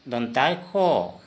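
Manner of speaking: दोंता खो said slowly, kh clear; slow model. Shown as a demonstration. Teacher repeats the Assamese sound slowly for learners. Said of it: "ऐसे तो दोंता सो होता है, लेकिन उच्चारण".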